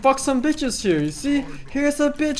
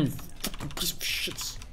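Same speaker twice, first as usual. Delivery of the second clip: whispered insult; 7 semitones lower, 15 dB quieter than usual. Real, not acted. Muttered insult.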